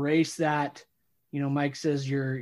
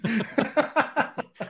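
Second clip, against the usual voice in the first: amused burst of laughter; laughing; 7 semitones higher than usual. Real, not acted. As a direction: laugh along at the joke. Laughs at the sponsor joke.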